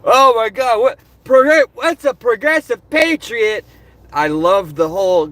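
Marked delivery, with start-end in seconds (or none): 0.0-3.6 higher, louder mock confusion; imitating a baffled YouTube commenter; confused commenter. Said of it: "I loved reading the comments like".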